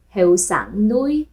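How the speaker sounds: usual speaking voice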